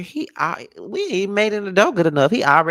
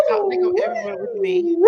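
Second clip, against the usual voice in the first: much higher, coy sing-song; flirty boast; faster than usual.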